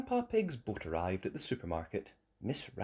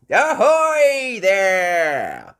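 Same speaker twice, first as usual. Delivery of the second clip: long, loud, high sailor's call; hearty hail; 10 semitones higher, 13 dB louder, much slower than usual. Acted. Grandpa Pig hailing from his boat, loud call. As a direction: holler it across the water, drawn out.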